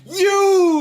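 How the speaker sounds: very high, loud, drawn-out mimicking cry; yelling back. Barbarian looking back at the flying devil, yelled back. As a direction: throw the screech back at the creature. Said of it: "I'm gonna look back at him".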